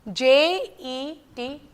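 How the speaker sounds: J E T, letters slow and apart; slow spelling. Shown as a demonstration. Teacher spelling for class, slow letters. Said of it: "I will say the spelling".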